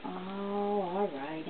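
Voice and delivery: ordinary delivery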